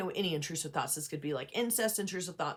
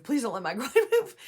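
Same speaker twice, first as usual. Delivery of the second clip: higher, louder plea breaking into a laugh; laughing mock plea; 6 semitones higher, 6 dB louder than usual. Therapist imitates anxious client's plea, laughing. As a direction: beg it with a laugh. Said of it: "I'm like".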